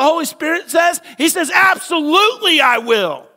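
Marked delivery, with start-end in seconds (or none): 1.5-3.3 high, loud enthusiastic reply; eager yes. Acted. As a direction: answer like you're thrilled to be asked. Holy Spirit's eager answer voiced.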